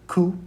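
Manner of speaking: "ku" as "koo"; Spanish letter name. Shown as a demonstration. Teacher modelling Spanish Q for students, "ku" said clearly. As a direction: model "ku" as one clear syllable. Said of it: "which is pronounced".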